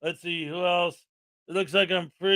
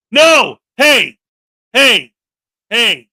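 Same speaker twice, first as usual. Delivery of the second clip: loud sharp yells; shouting; 2 semitones higher, 13 dB louder, much slower than usual. Real, not acted. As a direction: yell to stop them. Yelling at fighting guest.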